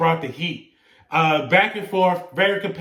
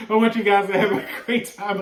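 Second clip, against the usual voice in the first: real laughter breaking through the words; trying to sign off but giggling; laughing; 3 semitones higher, faster than usual. Real, not acted. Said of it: "I can't even stop laughing".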